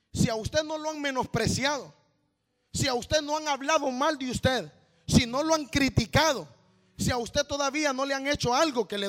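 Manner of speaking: loud, driving preacher's rebuke; preaching at full force. Acted. Preacher scolding ministers who won't suffer, preaching hard. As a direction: hammer it home to the congregation.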